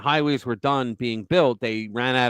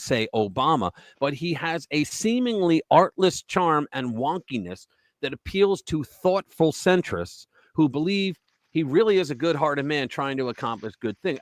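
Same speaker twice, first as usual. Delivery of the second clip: ordinary delivery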